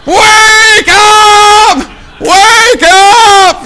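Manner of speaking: high, drawn-out holler; full-out wake-up scream. Retold or imitated. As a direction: bellow it down the whole street. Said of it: "not gonna hear you louder".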